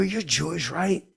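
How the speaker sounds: high, leading question; insistent question. Retold or imitated. Acted question in retold call.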